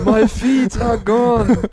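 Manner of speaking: high, loud, slow mock wail; hammed-up cry of disaster; mock panic. Interviewer playing an internet-outage victim, mock panicked wail.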